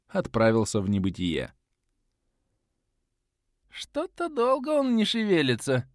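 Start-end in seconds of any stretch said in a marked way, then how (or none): 3.7-5.9 put-on high, creaky old voice; creaky old man. A narrator's reading. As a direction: rasp it like a frail old man. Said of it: "скрипучий старческий голос".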